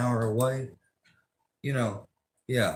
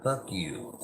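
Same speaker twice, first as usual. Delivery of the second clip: ordinary talk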